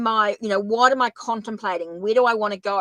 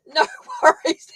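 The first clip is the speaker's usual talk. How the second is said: laughing; 7 semitones higher, 6 dB louder than usual. Real, not acted. Laughing after being misheard.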